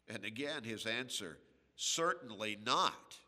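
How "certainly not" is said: louder Certainly not, hitting NOT; a firm, emphatic no; Paul's emphatic refusal, quoted firmly. Retold or imitated. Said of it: "it was emphatic, with emphasis".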